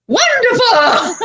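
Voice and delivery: high, very slow drawn-out Wonderful!; Matt Hardy impression. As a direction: sing out Wonderful! like an eccentric wrestler. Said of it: "Matt just walks by".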